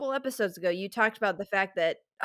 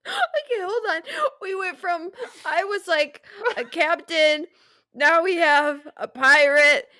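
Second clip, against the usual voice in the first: laughing; 5 semitones higher, 4 dB louder than usual. Real, not acted. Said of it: "laugh cry".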